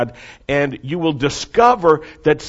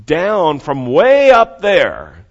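drawn-out emphasis; 4 semitones higher, 8 dB louder, much slower than usual. Real, not acted. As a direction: stretch every word out, slow and loud. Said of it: "preacher stretches the phrase".

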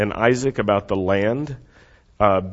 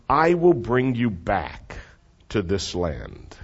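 slow, solemn promise; 3 semitones lower, slower than usual. Shown as a demonstration. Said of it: "So say that with me".

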